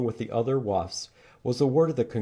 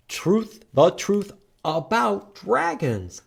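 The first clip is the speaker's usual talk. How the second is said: dramatic title read; 9 semitones higher, 6 dB louder, much slower than usual. Acted. Dramatic book title reading.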